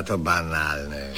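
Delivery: low, slow, bored dismissal; dismissive. Acted. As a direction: wave the idea away. Rejecting a costume idea.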